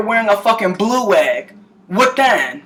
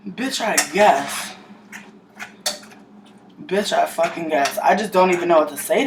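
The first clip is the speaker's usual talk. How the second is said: flat-out tired sarcasm, much lower and softer; weary resignation; 5 semitones lower, 6 dB quieter than usual. Real, not acted. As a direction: shrug it off, exhausted. Resigned reply to critics.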